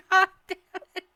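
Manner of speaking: high laughing outburst. Real, not acted. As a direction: crack up while cursing. Laughing at character shot.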